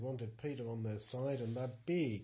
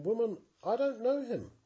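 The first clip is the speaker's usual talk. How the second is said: higher, louder protest from Peter; Peter's frightened denial; 9 semitones higher, 5 dB louder than usual. Acted. Peter denying the servant girl, a high, loud protest. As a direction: brush her off in a rush of fear.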